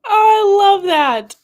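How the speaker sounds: high, loud cry of delight; gushing. Real, not acted. Streamer reacting to a viewer's book review, gushing.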